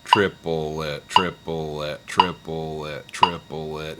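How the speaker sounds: measured, metronome-steady TRIPLE IT in three equal syllables; slowed triplet count. Shown as a demonstration. Teacher slowing the beat for students, steady low count. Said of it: "Let's slow it down a little more".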